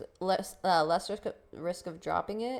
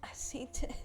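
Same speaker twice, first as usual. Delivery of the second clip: soft breathy whisper; 5 semitones higher, 6 dB quieter than usual. Real, not acted. Reacting to something on screen, in a soft whisper. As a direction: say it under your breath.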